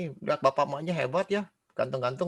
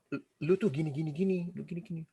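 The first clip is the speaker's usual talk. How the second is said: soft, tattling aside; gossiping go-between; 6 dB quieter than usual. Acted. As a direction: pass the gossip on under your voice. Go-between passes tale to B.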